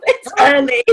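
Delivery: real laugh breaking into the words. Real, not acted. Caller admits to host she's in the bath, laughing. Joking about being in the bath.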